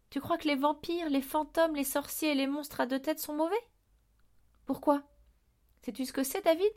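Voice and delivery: high-pitched impassioned questioning; intense, passionate. A narrator's reading. Headmaster questioning the boy's view of monsters, passionately. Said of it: "avec une intensité et une passion".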